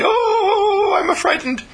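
high mock fearful cry; hammed-up fright, high pitched; frightened driver. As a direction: squeal it out like a scared driver.

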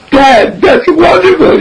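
high, drawn-out mock-tearful cry; moved to tears. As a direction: act a boy choking up at praise. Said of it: "he starts crying".

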